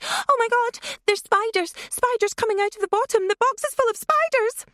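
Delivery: put-on a girl faking panic to scare her friend; fake panicked shriek. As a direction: shriek it as a prank, mock horror. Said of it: "Then Leah shrieked".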